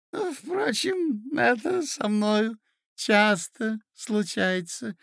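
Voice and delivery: put-on high, slow, giggling old man; doddering old prince. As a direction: ramble cheerfully like a senile prince. Prince rambling on.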